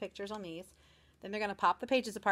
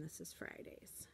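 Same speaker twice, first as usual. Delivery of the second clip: whisper; 3 semitones lower, 16 dB quieter, slower than usual. Real, not acted. Quiet aside about gifts.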